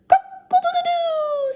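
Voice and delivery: high crowing cock-a-doodle-doo; rooster crow. A narrator's reading. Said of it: "says the rooster".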